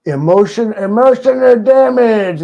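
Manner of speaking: loud, high mock Chinese accent on the meme line. Repeating the chat meme Outlaw read, loud mock Chinese accent. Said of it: "Outlaw read it in a Chinese accent".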